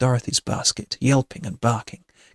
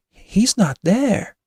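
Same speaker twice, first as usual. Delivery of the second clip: high, slow, loud shock; gasping alarm; 9 semitones higher, 5 dB louder, slower than usual. A narrator's reading. Kitty sees Tall Man is gone, gasping. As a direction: gasp it out in alarm. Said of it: "Kitty gasps".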